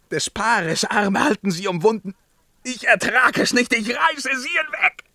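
a voice cracking upward in horror; frenzied outcry. Acted. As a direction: tear them away, beside yourself. Frenzied outburst at the vision.

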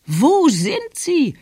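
high, drawn-out grandmother's cry; calling out. A narrator's reading. Grandmother demands to know where they are, calling out. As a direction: call it out slowly, pitched right up. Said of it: "rief Großmama".

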